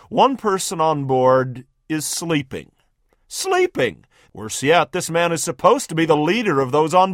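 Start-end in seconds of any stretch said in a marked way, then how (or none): 3.3-4.1 disbelief, pitch leaps way up; incredulous. Acted. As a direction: spit it out in shocked disbelief. One man sleeps through the storm.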